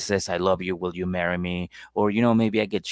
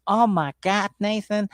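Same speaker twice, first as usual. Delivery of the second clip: put-on imagined girlfriend squeal; 11 semitones higher than usual. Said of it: "she would be like".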